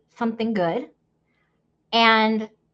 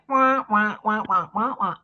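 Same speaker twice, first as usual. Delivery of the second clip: Peanuts teacher. Retold or imitated. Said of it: "ever seen the Peanuts gang".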